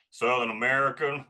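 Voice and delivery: Southern American drawl; Southern American accent. Shown as a demonstration. Improv performer showing the host a Southern American accent. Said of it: "my kind of drawl of a Southern American accent".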